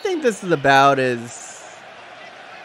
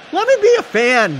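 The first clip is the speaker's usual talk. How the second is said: exasperated plea; 13 semitones higher, 5 dB louder than usual. Real, not acted. Pleading to chat.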